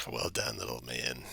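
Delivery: a low, quiet Barghest voice. Acted. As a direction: growl it low and soft, like a big beast. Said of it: "he's like".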